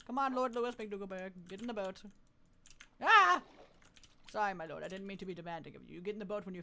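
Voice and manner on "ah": sudden high yelp; a short yelp of surprise; jumpy squeal; startled yelp. Real, not acted. Startled in the game.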